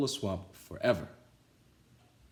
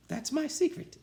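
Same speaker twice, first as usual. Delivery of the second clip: sweet teacher. A narrator's reading. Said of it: "said Missus Nelson".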